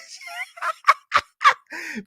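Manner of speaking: squealing delighted cackle; high gleeful shriek-laugh. Real, not acted. Cackling at the news.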